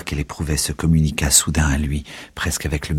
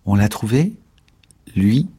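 demande-le timidement, voix qui monte; timide; 6 semitones higher, 4 dB louder than usual. Acted. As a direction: question timide, plus aiguë. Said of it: "demanda-t-elle timidement". (timide et aiguë)

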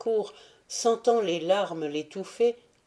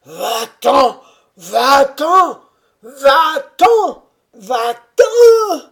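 rasping yells, each higher and louder, the last cracking; hoarse furious shout rising to a break; 8 semitones higher, 15 dB louder, much slower than usual. Acted. The old grandfather driving the prefect out, rasping shouts climbing to a break. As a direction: bellow him out, climbing until the voice breaks. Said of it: "une voix extraordinaire, une voix rauque, puissante et rouillée, cria".

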